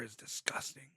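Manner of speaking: whispered disgust; whispering. Real, not acted. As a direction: breathe it out without voice. Tasting hot sauce, disgusted.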